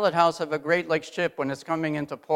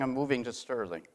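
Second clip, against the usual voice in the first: usual speaking voice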